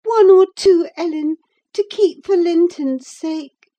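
high, tearful pleading; earnest supplication. A narrator's reading. Girl asking to keep some letters, supplicating. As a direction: beg in a high girlish voice. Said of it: "She earnestly supplicated".

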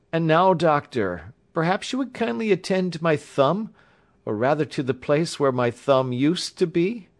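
high-pitched, polite patient voice; injured young engineer. A narrator's reading. Patient asking the doctor to attend his thumb, politely.

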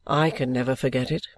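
a hint of Richard, low and earnest; Richard's line. A narrator's reading. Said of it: "said Richard".

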